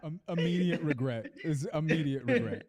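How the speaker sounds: laughing "immediate regret". Real, not acted. Laughing regret at teammates' teasing. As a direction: laugh through it.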